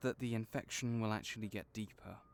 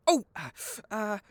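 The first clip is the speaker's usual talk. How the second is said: startled; 8 semitones higher, 8 dB louder than usual. Acted. Creature resists mouth being opened.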